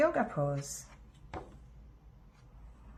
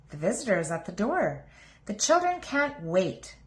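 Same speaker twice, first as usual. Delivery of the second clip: ordinary delivery, nothing marked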